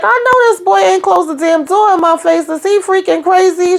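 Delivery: high-pitched mock outrage; retold indignation. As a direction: act out her disbelief at the slammed door. Acting woman shut out at door.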